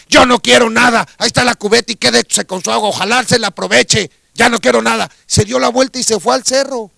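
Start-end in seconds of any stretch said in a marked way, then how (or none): 0.1-5.1 loud, higher, indignant outburst; angry rejection. Acted. Hermit angrily refusing the kind old woman, louder. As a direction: snap it back at her, louder.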